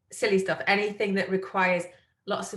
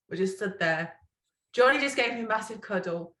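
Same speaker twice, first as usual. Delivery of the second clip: ordinary talk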